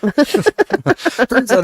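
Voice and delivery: laughing. Real, not acted. Laughing joke punchline.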